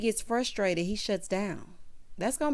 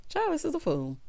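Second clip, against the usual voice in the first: ordinary talk, nothing marked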